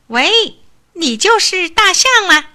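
high, loud cocky hail; cocky call. A narrator's reading. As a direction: call out like a swaggering little mouse. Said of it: "小老鼠问".

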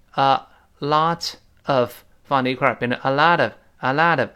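ordinary talk

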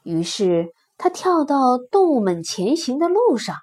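usual speaking voice

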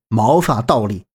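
ordinary delivery

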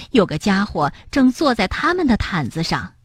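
ordinary delivery

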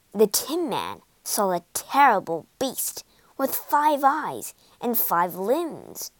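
ordinary delivery

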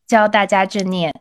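ordinary talk, nothing marked

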